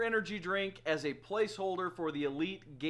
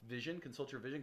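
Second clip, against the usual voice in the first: normal talking voice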